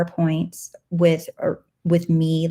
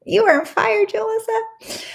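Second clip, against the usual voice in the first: high, bright cheering praise; excited cheer. Real, not acted. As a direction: hype up the viewer. Cheering on a viewer.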